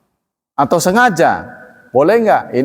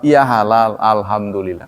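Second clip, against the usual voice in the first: calm gracious answer; 6 semitones lower than usual. Shown as a demonstration. How a seller should answer a customer asking about halal, calmly. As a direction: answer kindly, with no offence taken. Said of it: "Cukup jawab".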